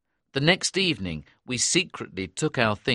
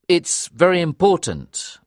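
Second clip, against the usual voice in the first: higher, emphatic dialogue; earnest insistence; 7 semitones higher than usual. A narrator's reading. Narrator telling companions about the chief's bark, earnest. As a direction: press the point earnestly. Narrator's own urgent quoted line.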